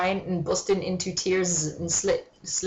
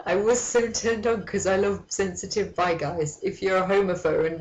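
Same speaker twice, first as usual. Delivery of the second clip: usual speaking voice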